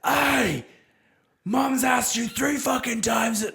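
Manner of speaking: high, loud, slow scolding shout; hot angry yell. Retold or imitated. Dad jumping in to back up his wife, yelling. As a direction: come in hot at the kids. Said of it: "full defense mode".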